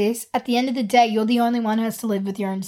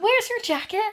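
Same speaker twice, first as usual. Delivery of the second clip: high put-on Irish accent; higher, lilting mock Irish; Irish TV quote; 9 semitones higher, 4 dB quieter than usual. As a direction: reel off an Irish schoolgirl's lines. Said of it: "I just love Dairy Girls".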